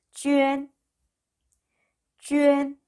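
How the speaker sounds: 쥐엔 held high and level; teacher's sustained high 쥐엔; model pronunciation. Shown as a demonstration. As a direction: glide 위 into 엔 in one long 쥐엔. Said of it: "이라고 읽으시면 되고요".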